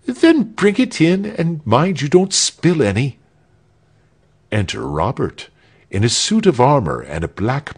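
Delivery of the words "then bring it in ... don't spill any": high, brisk executioner's order; calling instructions. A narrator's reading. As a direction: call out fussily to a servant. Executioner calls instructions through door.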